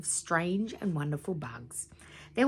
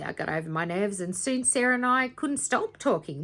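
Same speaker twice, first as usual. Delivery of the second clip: ordinary delivery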